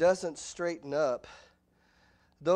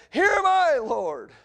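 loud, much higher eager reply; eager answer; 10 semitones higher, 10 dB louder, slower than usual. Retold or imitated. Boy Samuel answering God, loud eager cry. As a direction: answer the call with full eagerness. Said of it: "And he said".

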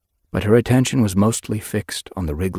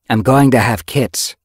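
loud, raised-pitch declaration; announcing; 7 semitones higher, 9 dB louder than usual. A narrator's reading. Yellowfang breaking the news to Ragged Pelt, announcing. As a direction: declare it firmly and loudly. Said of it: "she announced".

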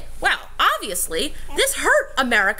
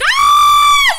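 real yelp; 12 dB louder than usual. Real, not acted. Bitten by the child.

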